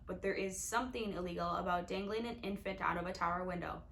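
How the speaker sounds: ordinary delivery, nothing marked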